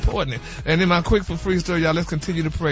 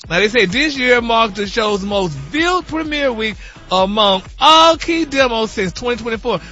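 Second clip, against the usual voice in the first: ordinary talk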